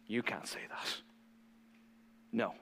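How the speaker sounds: whispered, breathless "You can't say that."; hushed mock outrage. Preacher voicing shocked leaders, whispered mock outrage. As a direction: hiss it like a scandalized insider. Religious leaders hate Jesus' statement.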